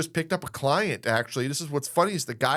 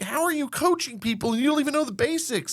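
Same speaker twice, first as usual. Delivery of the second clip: high, incredulous how-are-you question; incredulous challenge; 12 semitones higher than usual. Retold or imitated. Host confronting unqualified coaches, high incredulous challenge. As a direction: sound baffled at clueless coaches. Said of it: "and I'm like".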